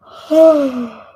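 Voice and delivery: loud, high falling sigh; heavy sigh. Real, not acted. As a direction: let out a big audible sigh. Loud sigh while reading chat.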